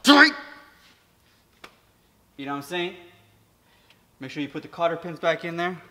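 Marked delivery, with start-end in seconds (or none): 0.0-0.3 Tight! yelled high and loud in one burst; sudden shout. Shown as a demonstration. Mechanic to viewers on tightening, sudden shout. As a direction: bark the word Tight! for emphasis.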